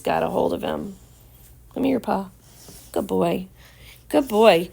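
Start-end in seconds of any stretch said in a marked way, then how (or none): 1.7-4.7 praising the dog. Real, not acted.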